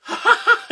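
high-pitched loopy mock cackle; loud, squealing imitation of a drugged giggle fit; Amos laughing. Drugged brother cracks up on the phone, mock laughing. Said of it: "and starts laughing".

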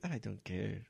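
low, soft, held-back quote; restrained first step. Retold or imitated. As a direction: start the imitated escalation quietly and low. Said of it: "el mae va como en un ascenso". (inicio contenido, bajito y grave)

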